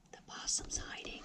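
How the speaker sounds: hushed storyteller's secret; whisper. A narrator's reading. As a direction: whisper it so the possum won't hear. Secret aside to the children.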